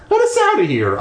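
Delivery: high-pitched urgent plea; pleading. Acted. Trapped kid begging the ghost, high pleading. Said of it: "I pleaded".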